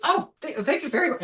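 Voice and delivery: put-on high, stammering child goblin voice; tiny goblin page voice; six-year-old goblin. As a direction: thank him like a shy little page boy. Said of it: "clearly some, like, six-year-old goblin".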